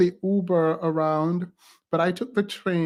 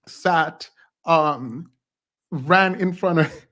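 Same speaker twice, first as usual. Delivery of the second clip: normal talking voice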